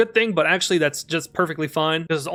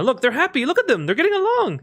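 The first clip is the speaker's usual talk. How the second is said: rising higher and higher, delighted; cooing; 9 semitones higher than usual. Real, not acted. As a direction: coo at the happy little pets. Cooing over pets getting along.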